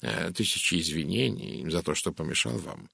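ordinary delivery, nothing marked

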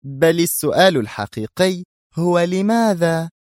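high, pointed boss's question; female boss. A narrator's reading. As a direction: pose the question with authority. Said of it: "قالت الزعيمة العبارة وهي تنفث دخان سيجارتها".

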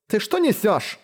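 furious. A narrator's reading. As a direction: snap back in anger. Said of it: "позеленела от злости".

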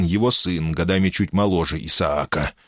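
ordinary delivery

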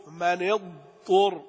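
slow, اضطر with a distinct ض before the ط; clear ض. Shown as a demonstration. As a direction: sound the ض fully in فمن اضطر. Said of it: "لا بد من بيان الضاد هنا". (بيان الضاد بوضوح)

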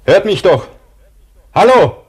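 loud, high shouts for help; desperate shouting. Acted. Buried man to people passing above, desperate shouting. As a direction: shout to be heard through the snow.

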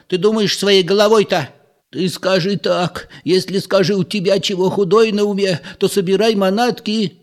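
high, insistent village mother nagging; scolding old mother. A narrator's reading. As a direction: press her daughter, fretful and stern. Mother scolding her daughter.

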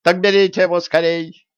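high, loud panicked squeal; scared merchant screaming; terrified shriek. Acted.

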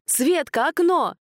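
high urgent shout. A narrator's reading. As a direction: yell the warning. Pavel shouting during a fight.

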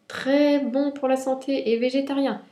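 high-pitched serving announcement; rabbit offering food. A narrator's reading. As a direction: sell the dish in a high voice. Rabbit presents the beetroot to the beasts.

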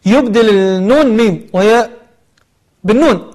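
normal talking voice

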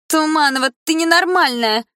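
put-on girl screeching across the classroom; shrieking yell. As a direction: friend of Malina shrieks at the heroine. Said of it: "закричала одна из подружек".

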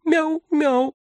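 very high little meow; small, high-pitched child's mew; thin squeak. A narrator's reading. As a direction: squeak it like a tiny kitten. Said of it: "тоненько пискнула Элька и зачем-то повторила".

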